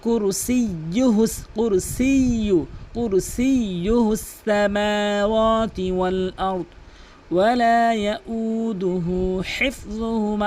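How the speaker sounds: slow, melodic chanted recitation; Quran recitation. Shown as a demonstration. Teacher recites for students, slow melodic chant. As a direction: chant the verse slowly with long held vowels.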